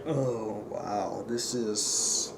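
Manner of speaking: very low, soft deflated murmur; underwhelmed. Retold or imitated. Viewer realising the movie is wrong, deflated. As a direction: let it drop, quiet and unimpressed.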